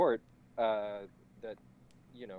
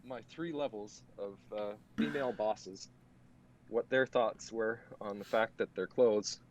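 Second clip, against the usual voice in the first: sarcastic; 9 dB louder than usual. Acted. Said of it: "yes, that was sarcasm".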